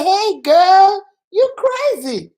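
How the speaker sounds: high, loud greeting, drawn out on crazy; playful teasing. Shown as a demonstration. Friend joking that a girl is crazy, playfully. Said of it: "the same thing I said".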